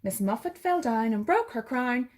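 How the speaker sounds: ordinary delivery, nothing marked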